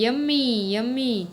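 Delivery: mildly delighted, drawn-out yummy; savouring delight. A narrator's reading. Rabbit answering whether he liked the roti, mild savouring delight. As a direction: savour it, pitch lifted then easing.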